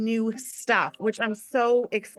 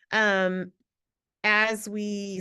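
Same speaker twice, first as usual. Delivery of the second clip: ordinary talk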